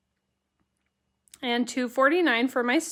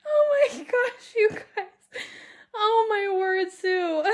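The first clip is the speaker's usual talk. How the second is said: laughing; 8 semitones higher, slower than usual. Real, not acted. Host laughing at comment.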